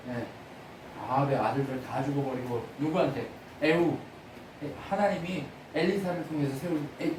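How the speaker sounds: ordinary delivery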